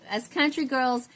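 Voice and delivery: put-on proud country girl in a pumped-up Texas accent; thick country accent. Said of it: "I put that accent on a little thick".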